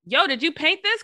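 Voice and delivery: excited. Retold or imitated. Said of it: "I'm like".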